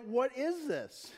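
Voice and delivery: slow, drawn-out amazed question; freaked out. Retold or imitated. People in Galilee reacting to the news, freaked out. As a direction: stretch it out in mild alarm. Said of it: "they were freaked out".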